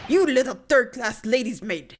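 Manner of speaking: a hammed-up Russian sailor, snarling; bad Russian accent. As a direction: growl it out in a mock Russian accent. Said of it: "my very bad Russian attempt".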